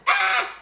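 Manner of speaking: loud, high, short shriek; excited squeal. Real, not acted. Streamer thrilled by her own rhyme, high squeal. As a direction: let out a piercing "Ah!".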